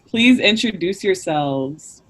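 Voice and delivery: ordinary delivery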